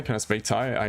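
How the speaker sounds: ordinary talk, nothing marked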